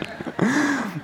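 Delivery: quiet high chuckle; soft amused laugh. Real, not acted. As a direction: let a little laugh slip out. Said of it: "But that's funny".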